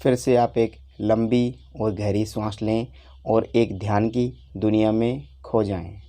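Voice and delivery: normal talking voice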